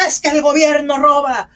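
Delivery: high, loud imitation of a street protester shouting; mock protest shout. Teacher imitates protesters yelling outside San Lázaro, mock protest shout. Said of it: "empiezan a gritar".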